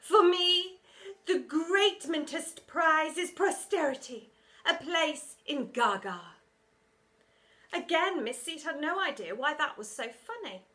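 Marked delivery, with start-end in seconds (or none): 0.0-6.4 high, loud, slow grandiose teacher; pompous teacher. A narrator's reading. Vain teacher boasting to the kids, high and grand. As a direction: savour every word of your own glory.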